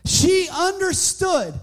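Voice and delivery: loud, high preacher's climax; fervent loud sermon peak; preaching at full force. Real, not acted. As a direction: drive the point home to the congregation.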